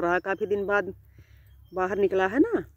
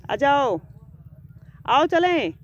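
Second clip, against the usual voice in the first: higher, louder call; 6 semitones higher, 4 dB louder, slower than usual. Real, not acted. Owner calling the pet to come along, loud high call. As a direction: call it over from a distance.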